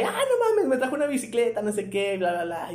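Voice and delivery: mucho más agudo, gritón de alegría infantil; hermano emocionado imitado. Retold or imitated. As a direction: imita al hermano que festeja su bici nueva. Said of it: "Y mi hermano llega y". (hermano que presume su bicicleta, emoción imitada muy aguda)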